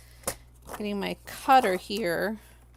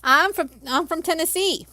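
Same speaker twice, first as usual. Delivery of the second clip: mock Southern accent; 6 semitones higher, 5 dB louder than usual. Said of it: "Southern accent".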